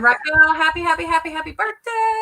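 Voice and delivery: high, loud, sustained cheer; excited birthday wish. Real, not acted. Host wishing Raquel a happy birthday, excitedly. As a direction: build the repeated word into a big high-pitched wish.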